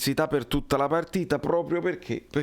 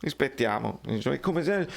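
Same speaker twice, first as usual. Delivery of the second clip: ordinary delivery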